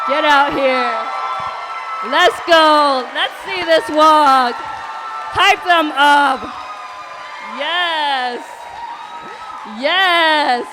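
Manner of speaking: loud, high, hyped-up shouting; fashion show emcee. Real, not acted. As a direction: hype the winner down the runway. Emcee calls winner onto runway.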